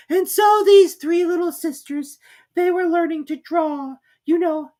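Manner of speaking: high, slow, drawn-out mouse voice; slow squeaky Dormouse. A narrator's reading. Dormouse answering Alice after long thought.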